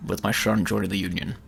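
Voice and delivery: put-on fail at Southern with a low Scottish accent; Sean Connery. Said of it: "you are a Southern coal miner".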